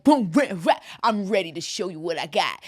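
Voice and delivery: low, cocky show-off impression; Sterling. Friend mimicking someone eager to show off, mock swagger. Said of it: "Some people are like Sterling".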